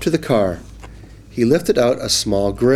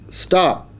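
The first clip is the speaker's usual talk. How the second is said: fish cutting in; 8 semitones higher than usual. Acted. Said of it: "said the fish".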